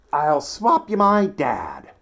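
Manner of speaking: high, loud, slow triumphant offer; boy offering his dad as a swap; proud offer. A narrator's reading. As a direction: announce the big idea grandly. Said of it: "I said".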